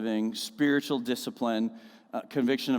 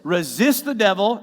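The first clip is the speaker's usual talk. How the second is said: proclaimed loud; 9 semitones higher, 9 dB louder than usual. Shown as a demonstration. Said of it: "Everybody say, "Resist".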